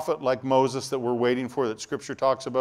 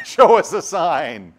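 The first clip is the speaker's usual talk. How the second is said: mocking disbelief; 6 semitones higher, 10 dB louder, slower than usual. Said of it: "What do you mean".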